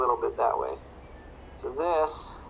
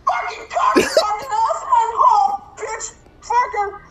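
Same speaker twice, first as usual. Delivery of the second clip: much higher, strained near-sobbing furious cursing; muttered rage returns; 7 dB louder, slower than usual. Retold or imitated. Father cursing once the child leaves, strained high fury. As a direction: rage through clenched breath, almost crying. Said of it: "she goes back to bed and he's just like".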